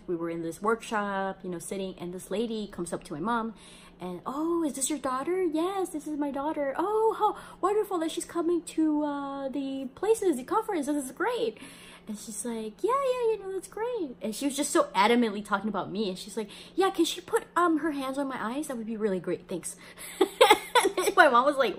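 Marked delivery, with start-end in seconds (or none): 4.3-11.6 high, gushing stranger voice; gushing lady. Retold or imitated. A stranger gushes to mom, much higher. 12.8-14.2 high, gushing stranger voice; gushing lady. Retold or imitated. A stranger gushes to mom, much higher. 16.7-19.7 high, gushing stranger voice; gushing lady. Retold or imitated. A stranger gushes to mom, much higher.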